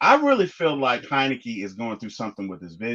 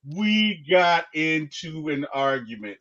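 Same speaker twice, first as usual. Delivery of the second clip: ordinary talk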